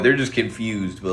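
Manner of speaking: usual speaking voice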